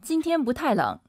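brisk native-speed Mandarin; fast everyday delivery; quick natural pace. Shown as a demonstration. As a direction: say the sentence quickly, running syllables together. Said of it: "선생님 빠르게 한번 해주세요".

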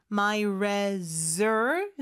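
"My reser" very slowly, with a buzzed Z; slow teacher's demo of Z in "reser"; clear Z sound. Shown as a demonstration. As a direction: stretch "reser" syllable by syllable, voicing the z. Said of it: "Z 발음".

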